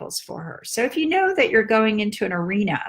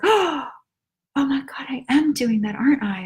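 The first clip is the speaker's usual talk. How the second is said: higher, whispered oh my God; mother's realization; 4 semitones higher than usual. Retold or imitated. Mother realizing she is doing it again, startled whisper. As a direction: catch yourself mid-sentence, startled.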